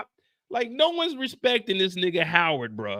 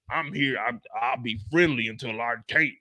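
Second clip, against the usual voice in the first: mock Howard, low voice; 9 semitones lower, 3 dB quieter than usual.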